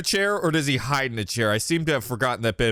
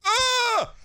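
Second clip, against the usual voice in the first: startled scream. Real, not acted. Jump scare.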